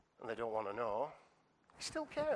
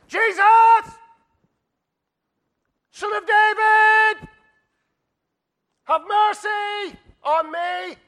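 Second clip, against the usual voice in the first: huge, high, drawn-out cry for help; full-force acted plea, each phrase stretched; shouting out; 20 dB louder, much slower than usual. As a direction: bellow it slowly over a crowd. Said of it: "He shouts out".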